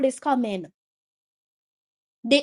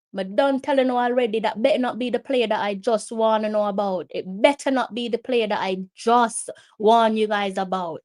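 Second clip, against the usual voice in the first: ordinary talk, nothing marked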